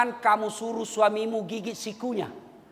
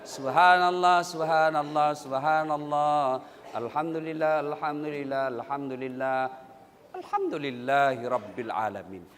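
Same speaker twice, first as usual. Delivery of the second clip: slow, softer devout chant; proper slow dhikr; 9 semitones lower, 3 dB quieter than usual. Shown as a demonstration. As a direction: recite each phrase calmly and clearly. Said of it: "Yang bagus". (zikir yang benar, pelan dan khusyuk)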